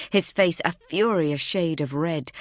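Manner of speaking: ordinary delivery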